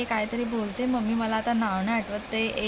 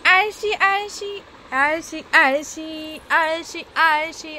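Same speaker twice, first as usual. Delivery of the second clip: teasing singsong; 4 semitones higher, much slower than usual. Real, not acted.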